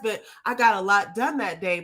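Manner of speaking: normal talking voice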